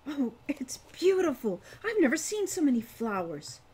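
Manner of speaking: put-on young sister thrilled by magical flowers, excited. A narrator's reading. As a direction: gasp at the beauty, delighted. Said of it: "flushed with excitement".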